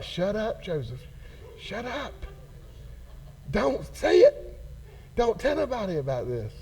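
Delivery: a hushed, urgent plea instead of a scream. Retold or imitated. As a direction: warn him softly to keep quiet. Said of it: "that's what I'm screaming when I'm reading this".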